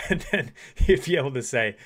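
talking through laughter; laughing. Real, not acted. Coach resuming her example, laughing through the words. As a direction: carry on while still laughing.

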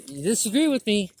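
ordinary talk

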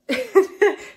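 high burst of laughter; big bright laugh; loud laugh. Real, not acted. Friend joins video.

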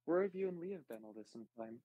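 ordinary talk

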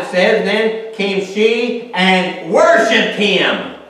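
loud, high preacher's cry; fervent preaching. Acted. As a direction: preach it out at full force. Preaching at full force.

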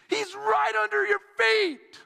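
high, urgent shouted warning; retold yell. Bystanders pointing out drowning boy, high urgent yell. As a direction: yell it out high to alert the lifeguard. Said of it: "they yelled at me".

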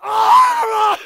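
high, loud yell; over-the-top scream. Acted. Actor overdoing a recorded scream, full yell. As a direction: scream it way too hard. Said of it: "that was way too much".

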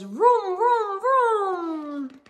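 high, loud vroom vroom like a toy car; car noises. A narrator's reading. A boy playing car on the way to school, vrooming loudly. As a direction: make the car sound like a playing kid. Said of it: "Lenny Loom says".

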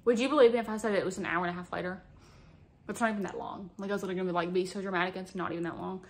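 usual speaking voice

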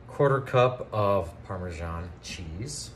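normal talking voice

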